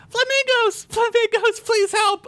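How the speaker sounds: put-on very high little-girl voice calling for help; shouting little girl. A narrator's reading. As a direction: cry out urgently like a small child. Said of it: "a little girl's voice shouted".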